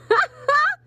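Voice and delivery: sudden squeaky burst of laughter; high laugh. Real, not acted. Reader laughing at the card to her viewers. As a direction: crack up at the card's wording. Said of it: "Are you guys laughing at this like I am?".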